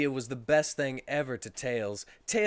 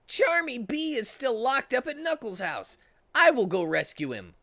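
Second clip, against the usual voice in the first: higher, put-on hero voice; Sonic character voice; 11 semitones higher, 5 dB louder than usual. Narrator voicing Sonic to friends, higher hero voice. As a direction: voice Sonic rallying the group.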